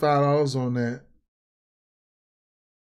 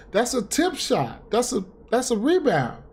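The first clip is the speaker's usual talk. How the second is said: much higher, exasperated insistence; a bettor protesting a stolen rebound, pitched up in disbelief; indignant protest; 7 semitones higher than usual. Real, not acted. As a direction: argue the bad call, voice climbing high. Arguing the call was a rebound.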